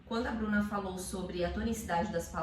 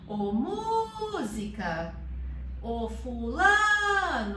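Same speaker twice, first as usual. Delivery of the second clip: drawn-out call on the stressed syllable; calling at the gate; 5 dB louder, much slower than usual. Shown as a demonstration. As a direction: stretch the stressed vowel like hailing a neighbour. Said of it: "chama no portão".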